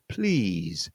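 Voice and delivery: please ending in a buzzing Z. Shown as a demonstration. Teacher demonstrating the Z at the end of please. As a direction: let please finish on a clear Z. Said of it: "That S E sounds like a Z".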